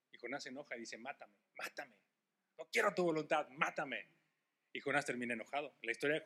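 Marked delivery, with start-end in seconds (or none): 1.0-4.1 Jonah begging to die. Retold or imitated. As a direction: play a sulking Jonah, muttered. Angry Jonah voiced.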